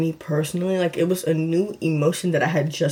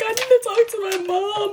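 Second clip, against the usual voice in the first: pitched way up, voice breaking in tears; high crying voice. Retold or imitated. Telling her best friend the test is positive, crying high. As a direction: wail it out through tears.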